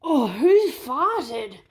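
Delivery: slow, drawn-out shocked question; wondering aloud. Acted. Animals wonder who farted, slow and shocked. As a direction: gasp it out, slowly appalled. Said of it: "whisper and wonder".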